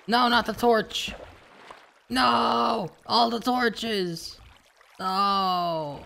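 high, drawn-out wail of loss; anguished high yell; dismayed shout. Real, not acted. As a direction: cry out loud and slow as it all goes. Losing torches in the game.

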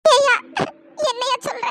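loud sobbing protest, then a broken question; tearful wife crying out at her husband's accusation. Acted. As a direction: wail his name through tears, then ask in disbelief. Mother cries at husband's blame.

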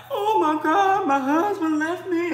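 high, tearful wail of a wronged wife; pleading in tears over the husband who left; crying. Shown as a demonstration. Crying to Eshu about a husband who left, tearful wail. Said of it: "and you crying and stuff".

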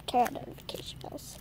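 breathy whisper. Real, not acted. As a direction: whisper it under your breath. Whispered outro aside.

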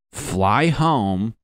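ordinary talk, nothing marked

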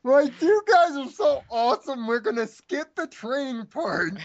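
high, gushing princess voice; gushing mock royal praise. Mocking Luna's overeager praise.

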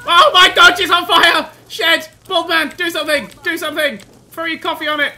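high, loud frantic yelling; panicked shouting. Acted. Player watching a Sim catch fire, shouting in panic. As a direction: scream for help as if someone's burning.

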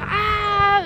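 long, high mock scream; loud sustained "ah" of pain; retold labour scream. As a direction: imitate screaming through a contraction. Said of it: "ich so voll am Schreien".

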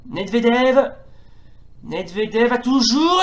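lively football play-by-play; french sports commentary. Acted. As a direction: call the match live, rising with each attack. (commentaire sportif à la télé)

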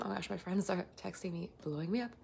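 ordinary talk, nothing marked

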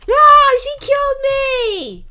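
very high, loud cry; outraged shout. Real, not acted. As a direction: shriek at being killed. Character gets killed.

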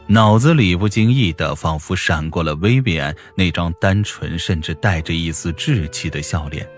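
plain reading voice

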